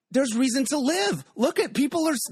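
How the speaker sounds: retold elation, pitched high; elated. Host tells theater founders his reaction, elated. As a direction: exclaim it high, as a joyful realisation.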